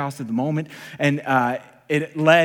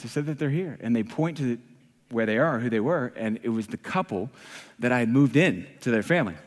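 normal talking voice

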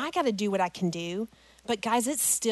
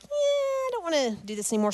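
put-on high-pitched mild kid whine; mock reluctant kids; 8 semitones higher, faster than usual. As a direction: lightly imitate kids who have had enough. Kids balking at the climb.

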